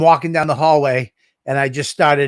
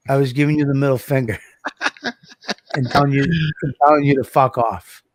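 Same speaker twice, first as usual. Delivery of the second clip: laughing through the quote. Retold or imitated. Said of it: "he goes".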